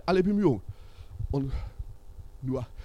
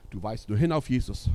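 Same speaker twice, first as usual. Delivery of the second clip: usual speaking voice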